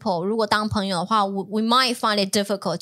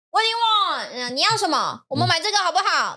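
high-pitched mock shout; imitated shouting; 9 semitones higher than usual. Imitating the shouty man in the shop, high mock shouting. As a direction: act out someone calling out, pitched way up. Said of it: "He was just shouting".

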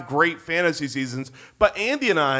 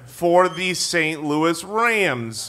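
grand announcement; much slower than usual. Acted. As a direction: announce the pick like a big reveal. Grand slow announcement of pick.

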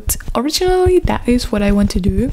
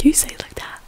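whispering; 7 dB quieter, faster than usual. Real, not acted. Whispered aside.

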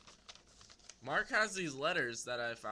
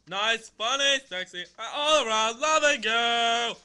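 loud, high, drawn-out mock love letter; hammed-up, half-sung letter reading; funny sing-song reading; 8 semitones higher, 15 dB louder than usual. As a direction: belt it out, stretching the words. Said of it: "I read them a funny way".

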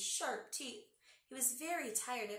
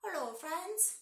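higher-pitched puppet hedgehog greeting; cute hedgehog puppet voice for children; Sammy; 6 semitones higher, 4 dB louder, slower than usual. Pretend. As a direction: play a little puppet animal saying hello. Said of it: "Sammy, say hello".

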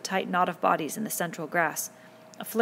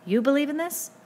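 high, rising disbelief; incredulous, pitch climbing to the end; amazed; 8 semitones higher, 4 dB louder than usual. A narrator's reading. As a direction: question him in astonishment. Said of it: "Ralph stood up in amazement".

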